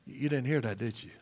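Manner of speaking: ordinary delivery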